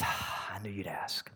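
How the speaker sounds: hushed, knowing whisper; secretive whisper. Retold or imitated. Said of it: "He goes".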